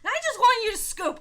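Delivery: high, loud mock urging; mock plea. Speaker silently urging the opponent to concede, a high loud mock plea. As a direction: urge him to give up. Imitating own thoughts to opponent.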